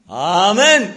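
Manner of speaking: a loud, high amen to close the point. Real, not acted. Preacher to the chapel, a loud ringing amen.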